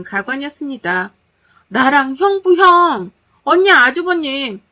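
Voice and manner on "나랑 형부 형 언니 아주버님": loud, high, drawn-out wail of outrage; can't-take-it-anymore outburst. A narrator's reading. Sister revealing her blind date to her older sister, outraged wail. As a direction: cry it out slowly, pushed to breaking point. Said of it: "더 이상 못 참겠다는 듯".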